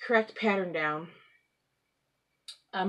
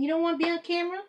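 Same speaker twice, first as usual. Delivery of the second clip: pet talk; 11 semitones higher, much faster than usual. Real, not acted. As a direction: coax the cat in a much higher voice. Coaxing the cat again.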